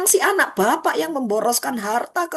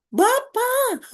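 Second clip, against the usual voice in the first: crying out; 10 semitones higher than usual. Acted. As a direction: call out to father in protest. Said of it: "Dia teriak kepada ayahnya".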